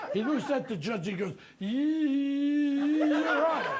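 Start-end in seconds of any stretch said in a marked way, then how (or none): 1.6-3.8 very slow, higher and louder stammer; stuttering lawyer. Retold or imitated. Legal aid lawyer to the judge, slow loud stutter. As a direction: drag out every sound, can't get it out. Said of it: "we're stuttering".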